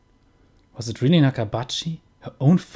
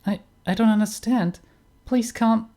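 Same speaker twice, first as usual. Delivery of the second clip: put-on much higher girl's voice, pleading; frightened young woman; 11 semitones higher than usual. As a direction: plead up high as the scared daughter. Daughter pleading in game.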